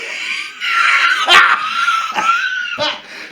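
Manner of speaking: very high, loud laugh near crying; shrieking laugh. Real, not acted. Reliving the snake trick, shrieking with laughter. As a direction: howl with laughter.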